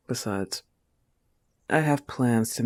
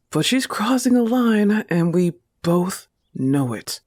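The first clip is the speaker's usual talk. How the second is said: high, loud indignant burst; loud, higher jealous flare-up; angry outburst; 7 semitones higher, 7 dB louder than usual. Acted. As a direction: let the anger break through. Jealous girlfriend losing her calm.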